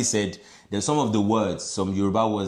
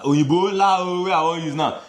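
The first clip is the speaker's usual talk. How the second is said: imitating her English-accented Yoruba; 8 semitones higher, 4 dB louder than usual. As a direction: parody a foreigner's Yoruba pronunciation. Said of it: "the accentuation".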